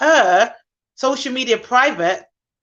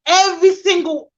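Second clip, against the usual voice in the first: "every single" higher and louder; emphatic every single; 6 semitones higher, 4 dB louder than usual. Real, not acted. Frustrated nanny advocate, "every single" punched louder. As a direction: hammer "every single" home.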